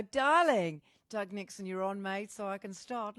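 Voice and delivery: usual speaking voice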